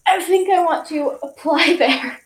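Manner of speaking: giggling through the quote; laughing. Retold or imitated. Telling viewers what she said, laughing. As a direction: laugh while retelling it.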